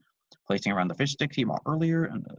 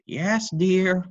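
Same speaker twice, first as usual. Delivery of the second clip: high loud answer; cried out; 10 semitones higher, 8 dB louder than usual. Acted. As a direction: call back to husband. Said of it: "Rita cried out".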